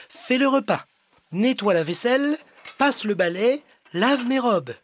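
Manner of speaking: high bossy princess barking chores; bossy orders. Acted. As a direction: rattle off orders like a spoiled princess. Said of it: "Elle ordonne".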